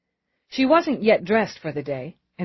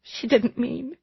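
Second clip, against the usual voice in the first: high, weak, anguished voice; broken, tearful denial; 7 semitones higher, slower than usual. Acted. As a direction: falter through tears, slowing down. Mother breaks down, excusing her daughter.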